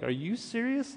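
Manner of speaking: high-pitched, incredulous question; retold heightened disbelief. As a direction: replay astonishment, pitch leaping up to a questioning end. Turns to husband, asks in disbelief.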